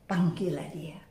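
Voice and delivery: very soft, low whisper; hushed, breath-only call; whispered summons. Retold or imitated. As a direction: whisper the quoted command. Preacher repeats the quote hushed.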